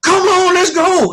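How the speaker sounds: a high, mock fan shout; playful retold holler from the stands; imitating a yell. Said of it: "he was yelling".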